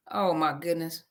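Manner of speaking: ordinary delivery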